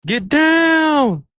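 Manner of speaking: high-pitched, drawn-out warning shout; yelled warning. Retold or imitated. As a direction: yell it out high, stretching the last word. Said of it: "And he yells".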